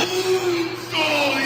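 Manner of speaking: sharp high gasp; surprised gasp. Real, not acted. Notices schedule error, surprised.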